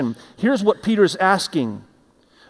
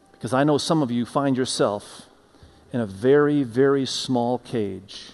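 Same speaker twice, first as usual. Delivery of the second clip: soft, low altar-call voice over music; prayer invitation; 5 semitones lower, 5 dB quieter than usual. Acted. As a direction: gently invite the church to bow and let go.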